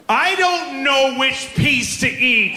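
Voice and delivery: put-on high, drawn-out toddler wail; slow, high-pitched tantrum whine; crazed three-year-old. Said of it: "her brain has to go somewhere crazy".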